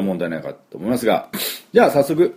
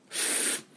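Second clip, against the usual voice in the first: voiceless F demo; 15 dB quieter than usual. Shown as a demonstration. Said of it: "フになりますからね".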